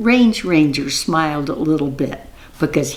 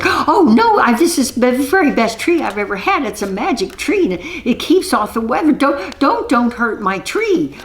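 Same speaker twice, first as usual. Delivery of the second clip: high, fast panicked plea; frantic pleading; 9 semitones higher than usual. Acted. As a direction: beg in a rushing high voice. Witch begs for her tree.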